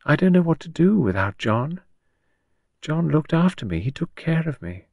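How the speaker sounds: lost widow, fretting aloud; bewildered Gerda. Acted. Gerda worries over children's questions, bewildered and plaintive.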